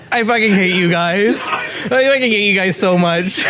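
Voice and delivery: high, laughing mock outrage; laughing protest. Real, not acted. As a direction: complain while cracking up.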